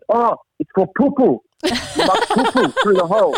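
imitated boy, very high Chinese-accented squeak; cute Chinese accent. Pupil answering the tour guide, high excited Chinese accent. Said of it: "with his cute Chinese accent".

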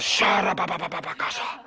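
hammed-up babbling prayer with a laugh; laughing parody of tongues; mock tongues. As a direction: parody a showy tongue-speaker. Mocking churchgoers praying in tongues.

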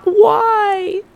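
"Why?" jumped very high and loud, whining; complaining why. Shown as a demonstration. Showing the bitter way of asking why, high whining wail. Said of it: "Gentle inflection reframing".

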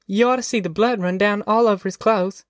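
put-on high-pitched little girl voice; child relishing a tale, pitched high; small high tattletale voice; gleeful tattling girl. A narrator's reading. Narrator voices young Ruthie.

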